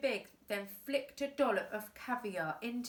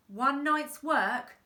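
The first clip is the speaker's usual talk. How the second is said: slow, louder scoff; mocking scoff; 5 semitones higher, 6 dB louder, slower than usual. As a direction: scoff at the idea. Boss scoffs.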